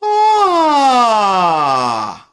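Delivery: loud full ah gliding down from high; full sound ah. Shown as a demonstration. Coach demonstrating to students a full open ah. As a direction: sing a full open descending ah. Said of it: "I get a full sound".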